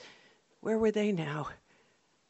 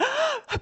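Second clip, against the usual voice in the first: gasp. Retold or imitated. Fuse lit, sharp gasp.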